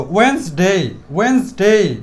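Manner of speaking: two syllables for ওয়েডনেসডে, linger on the last; changed sound. Shown as a demonstration. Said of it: "একদম সম্পূর্ণ change শব্দ". (ওয়েডনেসডে-কে টেনে "ওয়েন্সডেই" বলা)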